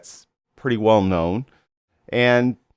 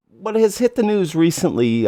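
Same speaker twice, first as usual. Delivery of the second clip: ordinary talk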